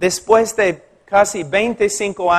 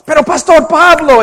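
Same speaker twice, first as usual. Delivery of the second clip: much higher and louder mock protest; objecting listener; 8 semitones higher, 7 dB louder than usual. A listener objecting to the pastor, high and loud. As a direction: voice the audience's challenge.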